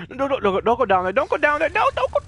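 rapidly climbing, high-pitched panic; frantic warning. Real, not acted. Player begging someone not to go down there, panicked. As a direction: plead urgently, pitch rising to a squeal.

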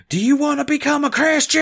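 high, loud preacher question; booming preacher. Retold or imitated. Preacher asks the newcomer to convert, loud and high. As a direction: put the question to him like a pulpit preacher. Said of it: "And he goes".

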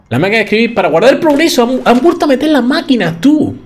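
high, quick burst of excitement; excited discovery. Real, not acted. Player to viewers on spotting the typewriter, excited. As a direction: blurt it out high and thrilled.

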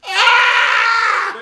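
loud, high laughing scream; real laughing exasperation; exasperated yell. Real, not acted. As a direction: yell in comic disbelief. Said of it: "why is any of this happening".

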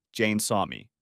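plain reading voice

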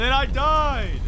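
high, loud burst of dismay; exasperated yell. Real, not acted. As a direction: wail at your own blunder. Laments own death.

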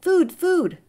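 high, loud chick crying for food; hungry gosling. A narrator's reading. Hungry hatchling begs the robot, high and loud. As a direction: squawk it like a starving hatchling. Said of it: "The gosling was hungry".

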